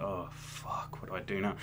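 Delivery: a groggy whispered panic; sedated whisper. Retold or imitated. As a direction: breathe it out, half drugged and helpless. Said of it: "I was like".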